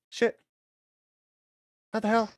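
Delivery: normal talking voice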